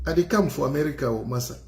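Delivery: Cameroonian pidgin, fast and mock-boastful; hammed-up pidgin English; pidgin English put-on. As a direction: play the broken-English traveller. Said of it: "Non, je vous mens. Mon anglais est quand même meilleur".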